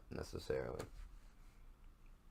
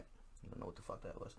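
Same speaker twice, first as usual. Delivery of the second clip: whisper; 15 dB quieter than usual. Real, not acted. Baffled aside after impression.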